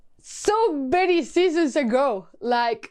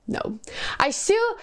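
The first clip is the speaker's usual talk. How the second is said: usual speaking voice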